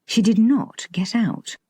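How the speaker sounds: usual speaking voice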